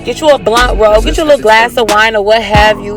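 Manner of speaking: normal talking voice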